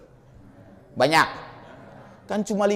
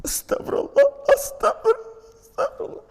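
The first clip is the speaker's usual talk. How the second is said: pleading istighfar. Pretend. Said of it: "kalau kita beristighfar sama Allah".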